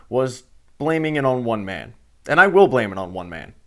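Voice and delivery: ordinary talk, nothing marked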